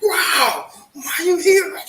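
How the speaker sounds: high, loud, surprised cry; excited. Retold or imitated. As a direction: burst out in startled delight. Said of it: "I was so excited".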